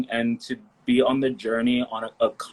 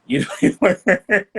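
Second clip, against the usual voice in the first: laughing; 10 semitones higher, 3 dB louder than usual. Real, not acted. Teasing compliment, bursts out laughing.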